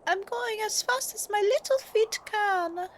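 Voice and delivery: very high, slow little critter; tiny creature voice. Acted. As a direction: squeak it out slowly, small and strained. Tiny character answers slowly.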